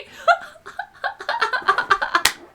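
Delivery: long high laugh. Real, not acted. Cracking up at her own mean girls story, high laughing.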